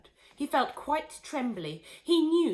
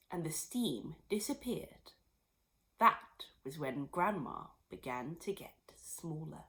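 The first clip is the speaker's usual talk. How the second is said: hushed narration; 4 semitones lower, 5 dB quieter than usual. A narrator's reading. Narration drops to hush.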